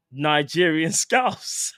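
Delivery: slow, laughing read-out; cracking up. Real, not acted. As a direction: drag it out and break into laughter. Reads a comment, cracking up.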